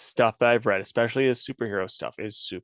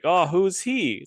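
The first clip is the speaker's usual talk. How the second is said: higher, drawn-out mock question; X-Men announcer voice; 7 semitones higher, slower than usual. Co-host voicing a baffled Wolverine reader, mock announcer voice. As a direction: play the puzzled reader in a cartoonish announcer skit. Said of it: "your X-Men announcer voice".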